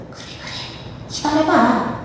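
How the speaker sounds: hushed, sly tempter; coaxing whisper. Acted. Preacher voices Satan telling a believer to wait, coaxing whisper. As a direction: whisper it like a tempter stalling someone.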